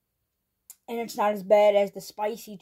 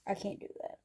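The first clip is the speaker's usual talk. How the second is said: whispered defeat; 2 semitones lower, 16 dB quieter, faster than usual. Real, not acted. Gives up on spicy challenge.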